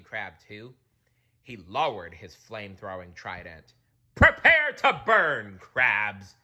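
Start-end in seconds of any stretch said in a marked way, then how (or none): 4.1-6.3 high, loud, slow threat; a royal death sentence; menacing king. Acted. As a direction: promise to burn him. King aims trident.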